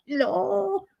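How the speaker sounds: retold sharp high no; indignant no. To people calling her accent German, a high indignant no. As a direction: fire back a high, flat-out denial. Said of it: "And I'm like".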